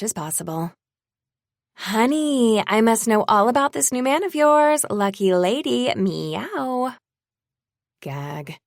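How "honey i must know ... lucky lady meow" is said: high, loud, overly sweet busybody; gushing gossip. Acted. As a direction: gush it like a catty fake friend. Gossip's fake-friendly text read in mockery.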